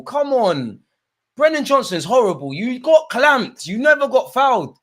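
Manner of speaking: high, loud complaint at a player; indignant outburst. Real, not acted. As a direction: protest loudly, pitch way up. Protests at player claiming foul.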